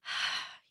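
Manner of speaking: soft, breathy sigh letting the stress out; relieved sigh. Retold or imitated. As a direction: let out a long quiet sigh of relief. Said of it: "his body goes".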